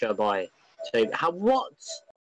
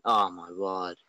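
ordinary talk

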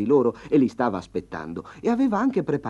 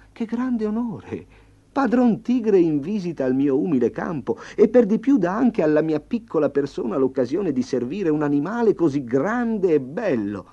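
high, loud, fawning peasant voice; bow and scrape with a high eager voice; flattering farmer; 7 semitones higher, 5 dB louder than usual. Acted. The farmer flattering the tiger, high and loud fawning.

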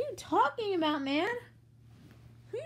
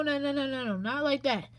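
ordinary talk

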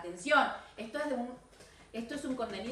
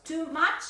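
too much, much higher and louder with a rise; stress on much; 4 semitones higher, 5 dB louder than usual. Shown as a demonstration. Teacher contrasting too much, much raised and louder. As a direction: lift and push much.

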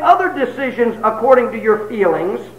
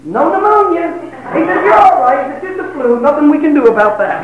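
higher, brisk doctor voice; doctor reassuring patient, higher; doctor's verdict; 7 semitones higher than usual. Retold or imitated. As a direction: deliver it like a doctor.

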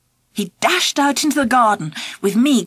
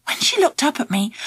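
usual speaking voice

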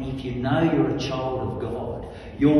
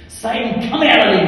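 raised, high exorcism command; commanding rebuke; 9 semitones higher, 9 dB louder than usual. Retold or imitated. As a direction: re-enact ordering a demon out. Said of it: "I've commanded people".

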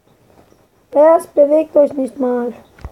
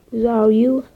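ordinary talk

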